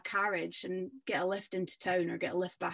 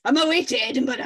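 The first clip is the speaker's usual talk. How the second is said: loud, quick, higher huffy retort; the rude rider huffing off to Edinburgh, loud and fast; 6 semitones higher, 9 dB louder, faster than usual. Acted. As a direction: storm off with an indignant snap. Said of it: "with a huff".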